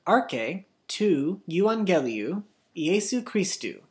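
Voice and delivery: the whole Greek phrase read slowly; careful, slowed recitation; slow full reading. Shown as a demonstration. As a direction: read the full verse slowly for the class to echo. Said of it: "Now the whole thing".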